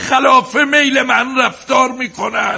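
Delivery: high, drawn-out shout; angry complaint. Acted. Husband railing at his wife that everyone defies him, angry shout. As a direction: shout your grievance slowly at full pitch.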